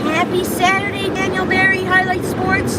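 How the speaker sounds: high and loud, bright greeting; excited shout-out. Real, not acted. Streamer shouting a happy Saturday greeting to a viewer, excited. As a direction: call the name out like an announcer.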